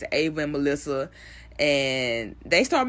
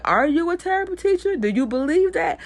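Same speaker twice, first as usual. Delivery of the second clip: much higher, louder, challenging; Barb's tough love; 12 semitones higher, 4 dB louder than usual. Retold or imitated. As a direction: call her bluff like a stern mentor. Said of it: "She said".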